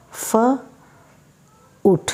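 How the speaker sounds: foot split into f and oot; sound-by-sound demo. Shown as a demonstration. Teacher sounding out "F-oot" for students. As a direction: say "F-oot" in two parts, f then oot.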